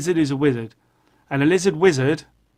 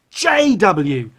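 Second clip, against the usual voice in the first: ordinary delivery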